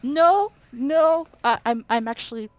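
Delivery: loud, fast, frightened cries; panicked. Real, not acted. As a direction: yell out in real panic. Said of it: "I hear it in my ears".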